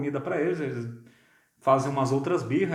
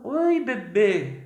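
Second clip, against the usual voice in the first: put-on higher, slow sing-song greeting to a baby; baby talk; 7 semitones higher, 5 dB louder, much slower than usual. Adult greeting a baby in a cooing baby voice. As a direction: coo at the baby. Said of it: "você olha ali e fala".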